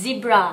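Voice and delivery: "zebra" starting on a clear voiced Z; emphasised Z. Shown as a demonstration. Mommy repeats it for child, voiced Z in "zebra". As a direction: buzz the first sound of "zebra". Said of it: "มันเป็นตัว Z มันต้อ".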